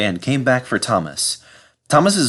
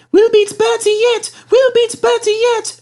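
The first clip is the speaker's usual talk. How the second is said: very high, loud eager chant; eager echo; 6 dB louder than usual. Acted. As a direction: echo it, fired up. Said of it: "echoed Annie and Clarabel".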